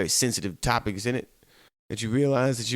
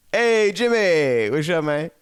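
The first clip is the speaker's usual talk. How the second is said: much higher, louder and slow, a hammed-up bright hello; perky greeting; 7 semitones higher, 6 dB louder, slower than usual. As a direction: greet him like you're suddenly wide awake. Perked-up greeting at nine.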